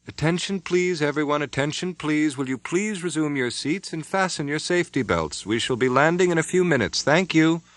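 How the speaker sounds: high, clear announcing voice; cabin announcement. A narrator's reading. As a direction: address the whole cabin calmly.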